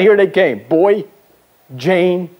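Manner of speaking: normal talking voice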